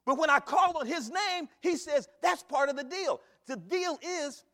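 loud, high preaching cadence; preaching at full force. Real, not acted. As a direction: ring it out to the congregation.

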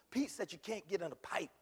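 ordinary delivery

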